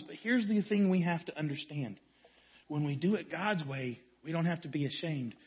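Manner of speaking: soft, low ending that fades away; fading to hush. Real, not acted. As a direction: let it sink into a quiet close. Closing point fading to hush.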